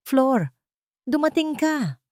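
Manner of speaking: high cracking plea of a ruined man; husband lightly voiced, pleading through the prison speaker; broken, pleading. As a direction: beg her with a breaking voice. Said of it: "ay basag at puno ng pagmamakaawa".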